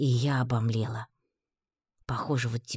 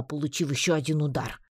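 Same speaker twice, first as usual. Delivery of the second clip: ordinary delivery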